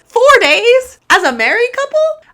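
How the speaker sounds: high loud astonished four days; shocked disbelief. Real, not acted. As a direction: gasp it out, truly stunned. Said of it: "shocked reaction".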